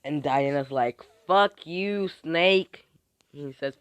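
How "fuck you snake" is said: high, loud jeer; acted taunting insult. Diana insulting her rival, loud taunting. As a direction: spit it at a rival, pitched up.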